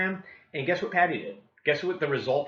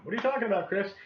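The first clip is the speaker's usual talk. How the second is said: higher, puzzled question; puzzled homeowner; 7 semitones higher, 3 dB quieter, much faster than usual. Retold or imitated. Homeowner puzzled by the agent's pitch, higher. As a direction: play the confused neighbor. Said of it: "homeowner replies at door".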